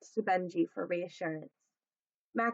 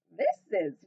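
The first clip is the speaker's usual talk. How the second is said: neighing horse; 6 semitones higher, 5 dB louder, much slower than usual. Acted. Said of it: "she neighed".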